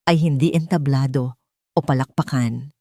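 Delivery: plain reading voice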